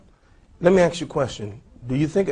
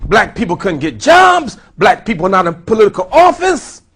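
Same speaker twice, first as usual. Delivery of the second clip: fervent preaching; 6 semitones higher, 9 dB louder than usual. Acted. Preaching at rising force.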